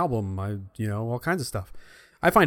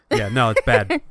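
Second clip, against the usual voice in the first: normal talking voice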